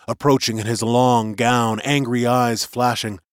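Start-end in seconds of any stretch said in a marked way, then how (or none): none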